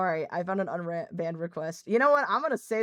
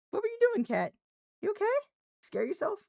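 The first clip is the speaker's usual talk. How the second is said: pet-talk; 12 semitones higher, faster than usual. Real, not acted. Startled cat, pet voice.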